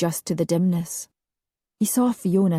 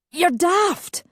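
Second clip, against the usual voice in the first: high protest; 12 semitones higher than usual. A narrator's reading. Alistair crying out again.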